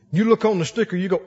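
ordinary delivery

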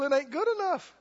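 ordinary delivery

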